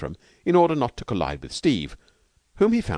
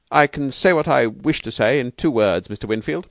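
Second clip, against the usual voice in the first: stiff objection, pitched higher and louder; indignant Bailey; 7 semitones higher, 5 dB louder than usual. Acted. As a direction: lay down the law, indignantly. Narrator voices indignant visitor Bailey.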